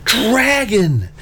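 high, drawn-out cry of alarm; high-pitched storybook alarm; urgent warning. A narrator's reading. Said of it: "she hissed".

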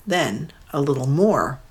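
plain reading voice

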